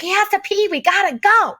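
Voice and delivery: high, hammed-up urgent alarm; mother faking urgency to her son to flee; mock panic. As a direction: fake a frantic emergency to escape. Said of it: "I was like".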